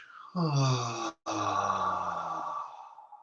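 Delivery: slow breathy sigh sinking down; long releasing sigh. Shown as a demonstration. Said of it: "Pull down".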